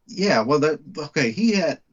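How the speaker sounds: ordinary talk, nothing marked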